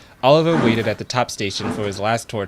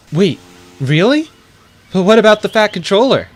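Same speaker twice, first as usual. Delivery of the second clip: surprised delight; 9 semitones higher, 7 dB louder than usual. Acted. Elizabeth surprised by offer.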